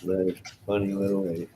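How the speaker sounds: ordinary delivery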